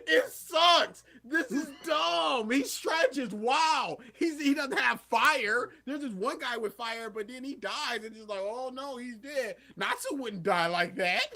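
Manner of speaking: high, loud ranting argument; retold fan-feud tirade, worked up; almost screaming. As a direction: shout down a rival fan. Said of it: "almost screaming match of me just going".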